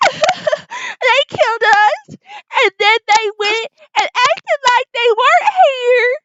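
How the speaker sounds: very high, wailing acted hysteria; hysterical accusation. Revived lady recounting the killing, hysterical wailing. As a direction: wail the story out in mock panic. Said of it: "And she says".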